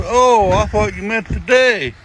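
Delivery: loud, high, drawn-out punchline; mock sudden realization. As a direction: belt out the dawning realization, slowly. Buddy's realization as joke punchline.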